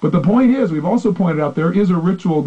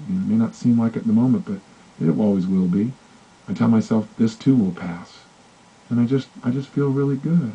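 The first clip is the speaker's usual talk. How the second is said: softer, lower, settled calm; calm relaxed tone; 6 semitones lower, 5 dB quieter than usual. Retold or imitated. Client recalling a moment of peace, calm and soft. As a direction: sink into quiet contentment. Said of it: "I began to talk to myself in this tone of voice, and I had this tempo".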